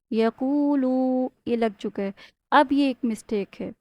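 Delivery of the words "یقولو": slow یقولو with an added و; wrong recitation. Shown as a demonstration. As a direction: stretch the final لو long. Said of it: "واو ساکن accidental لگ چکا ہے". (واو ساکن والی غلطی)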